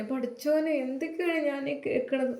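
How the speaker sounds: crying mother. Acted. Old mother crying to her son about the arrest, soft and high. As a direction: wail it softly through tears. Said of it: "Jameela ഉമ്മ കരഞ്ഞു".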